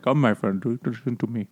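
lightly mocked Indian accent; imitated Indian accent. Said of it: "Stephen Fry nails the voice".

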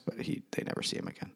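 whispered aside. Real, not acted. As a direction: drop to a hushed whisper for the grim aside. Dark aside to listeners.

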